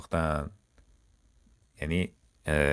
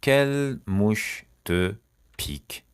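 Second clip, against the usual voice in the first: "Quelle mouche te", pause, "pique", very slowly; slow word by word; 3 semitones higher, much slower than usual. Shown as a demonstration. Teacher showing learners an idiom, slowly word by word.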